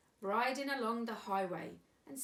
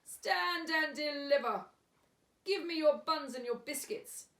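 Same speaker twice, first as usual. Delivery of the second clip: thundering; 7 semitones higher, 6 dB louder than usual. A narrator's reading. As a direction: thunder it at the squirrel. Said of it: "who thundered".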